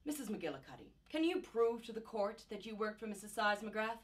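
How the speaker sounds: ordinary talk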